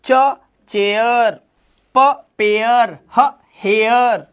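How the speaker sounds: slow, sound by sound: "च चेयर"; "प पेयर" split into the sound and the word. Shown as a demonstration. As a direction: sound out each letter first, then the word, slowly. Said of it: "एयर की आवाज निकालो".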